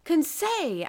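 a high, loud anguished cry of his name. A narrator's reading. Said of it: "I cried".

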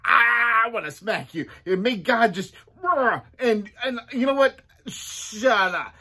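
high, loud angry retort; loud high shut up; fed-up outburst. Acted. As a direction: snap at them, fed up. Job furious at friends.

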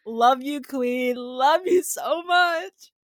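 adoring, building to a high drawn-out finish; gush, stretching out the last words; gushing fan. Pretend. Fan praising Rebecca for the slam, gushing. Cheering on a favourite character.

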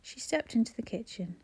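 ordinary delivery, nothing marked

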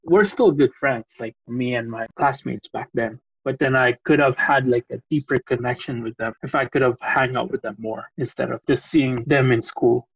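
ordinary talk

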